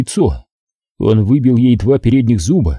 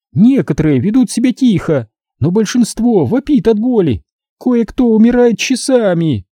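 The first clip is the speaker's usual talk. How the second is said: higher, taunting woman's voice full of contempt; gloating female captor; 11 semitones higher than usual. A narrator's reading. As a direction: sneer it at the rich man, pitched up. Captor relishes, slower. (злорадная похитительница, высоким голосом)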